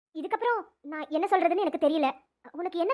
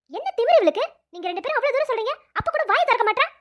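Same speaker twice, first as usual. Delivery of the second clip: louder, higher, outraged; indignant outburst; 4 semitones higher, 5 dB louder than usual. Acted. Teacher to both girls, fuming at the silent one. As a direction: fume at her arrogance.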